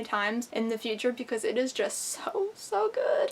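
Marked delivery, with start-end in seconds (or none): 1.7-3.3 high-pitched, drawn-out gush. Real, not acted. Book reviewer gushing over a reread she loves, high-pitched gush. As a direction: squeal softly over something you adore.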